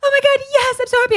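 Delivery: very high, gushing squeal; overjoyed. Retold or imitated. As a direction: re-enact a burst of joy for a friend. Re-enacts first joy at the news.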